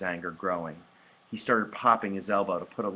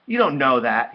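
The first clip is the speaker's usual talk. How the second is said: loud, higher snap back; angry retort; 5 semitones higher, 11 dB louder than usual. A narrator's reading. Hurt brother contradicting his sister, angry. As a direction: argue back hotly.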